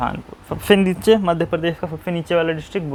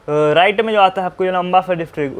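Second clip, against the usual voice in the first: usual speaking voice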